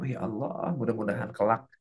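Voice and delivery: low, hushed supplication; subdued, low blessing; teacher's prayer. Shown as a demonstration. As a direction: pray earnestly under your breath for your pupils. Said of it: "I pray for you".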